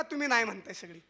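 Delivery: ordinary talk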